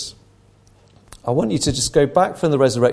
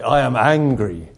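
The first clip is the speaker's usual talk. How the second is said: slow, louder angry declaration; loud anger; 3 dB louder than usual. Retold or imitated. Principal repeating himself from the pulpit, loudly angry. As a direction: repeat it loudly, drawing out each word. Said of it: "he repeated it loudly".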